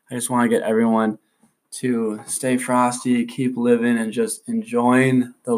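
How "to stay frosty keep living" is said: usual speaking voice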